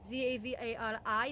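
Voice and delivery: an unplaced Eastern European-sounding accent; her D and D accent. Shown as a demonstration. Showing off her favorite accent for a D and D character. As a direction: speak in Zavari's foreign-sounding accent. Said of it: "there's one accent I can do".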